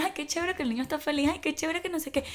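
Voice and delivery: rapid singsong approval, imitated; mock gushing. Mimics praise of a happy child.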